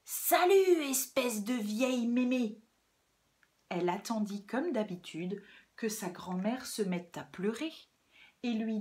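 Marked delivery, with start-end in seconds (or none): 0.0-2.6 loud, high cry falling away; brash little girl's shout; shouted greeting. Acted. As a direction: burst in yelling rudely. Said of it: "le petit chaperon noir entra et cria".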